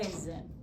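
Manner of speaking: whisper-light zin for the schwa; quiet shortcut syllable. Shown as a demonstration. Teacher explaining schwa, saying the syllable quietly. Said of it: "we say kind of quietly and in a shortcut".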